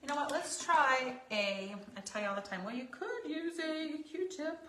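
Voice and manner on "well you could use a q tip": put-on slow, high old lady voice. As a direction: croak it like an old granny. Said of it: "in my old lady voice".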